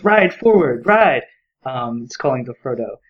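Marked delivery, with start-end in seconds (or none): 0.0-1.2 higher, louder call to ride; mild mock yell urging the rider on; read-aloud shout of Ride forward; yelled command.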